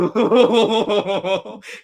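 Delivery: high laugh. Real, not acted. Laughing at funny scene.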